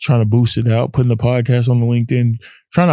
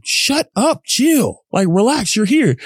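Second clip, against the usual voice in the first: high, urgent inner voice telling himself to calm down; self-talk; 11 semitones higher, slower than usual. Retold or imitated. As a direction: snap at yourself, much higher. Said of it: "talk to myself and be like".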